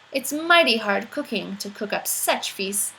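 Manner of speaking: plain reading voice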